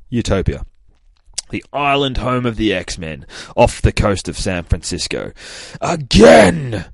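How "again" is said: loud, high barked command; drill-sergeant yell at a trainee; Cyclops screaming. Retold or imitated. Cyclops yelling at Hope during sparring, loud command. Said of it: "Cyclops screaming at Hope".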